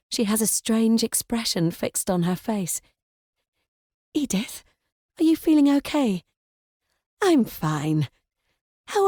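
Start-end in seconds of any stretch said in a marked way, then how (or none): none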